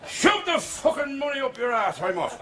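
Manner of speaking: high, hammed-up Irish indignation; Irish fella. Irish customer storms off, high mock Irish outrage. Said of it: "she's got this Irish fella".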